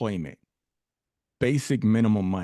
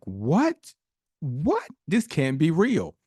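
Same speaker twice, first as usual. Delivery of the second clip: high-pitched retold astonishment; gasp-like rising questions, then a louder exclamation; disbelieving; 7 semitones higher, 4 dB louder, slower than usual. Retelling his shock at the email.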